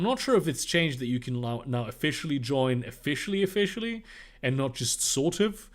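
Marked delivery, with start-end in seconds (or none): none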